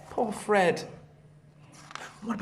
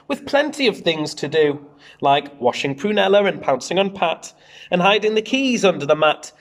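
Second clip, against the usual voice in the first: put-on lively read-aloud to children; bouncy storybook narration; story reading; 4 dB louder than usual. A narrator's reading. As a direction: read it out like a picture book for the class. Teacher reads picture book aloud.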